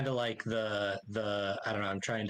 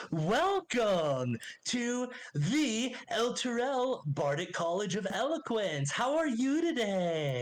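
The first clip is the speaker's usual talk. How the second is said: high, drawn-out singsong welcome; over-the-top greeter voice; gushing greeters; 9 semitones higher, slower than usual. Acted. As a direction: welcome them with exaggerated, high cheer. Bards greet newcomer in chorus.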